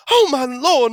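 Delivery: high, loud horrified scream. A narrator's reading. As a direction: scream it like a shocked mother. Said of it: "Mama screamed".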